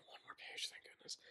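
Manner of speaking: whispered aside, worn out by the chapter; weary whisper. Real, not acted. Reader sick of the bad book, whispering relief. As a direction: breathe it, tired of reading. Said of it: "how much more of this chapter".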